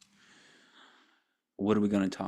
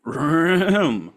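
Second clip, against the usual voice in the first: loud vocal warm-up; 8 semitones higher, 11 dB louder than usual. Acted. Vocal warm-up before show.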